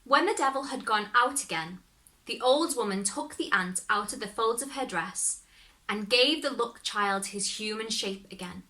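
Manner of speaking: ordinary delivery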